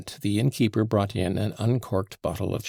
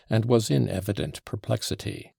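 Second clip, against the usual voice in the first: plain reading voice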